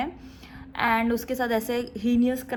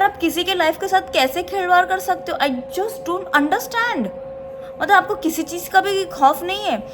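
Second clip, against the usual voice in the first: exasperated outcry; 3 semitones higher, 3 dB louder than usual. Real, not acted. Outraged rant about city crime.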